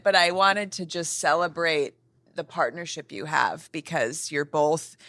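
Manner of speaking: ordinary talk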